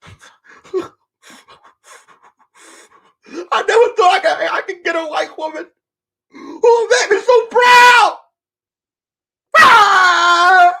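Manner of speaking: man overwhelmed with pride, hammed-up crying; mock sobbing shout. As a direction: wail it out like a man overcome. Mock husband breaks into crying shout.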